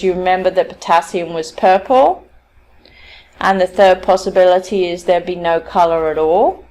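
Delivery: checking for feedback, with "purple" and "at all" lifting up; rising tone. Retold or imitated. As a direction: raise the ends on "purple" and "all", inviting agreement. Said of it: "using the rising tone, asks for some kind of feedback".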